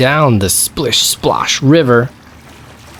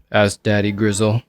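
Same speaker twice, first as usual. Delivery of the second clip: usual speaking voice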